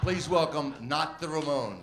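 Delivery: loud announcer, slowing on the name; stage introduction. Retold or imitated. Emcee repeats the opener's introduction to the punk crowd, loud announcement. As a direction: boom out a mock emcee welcome. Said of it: "All the audience heard was".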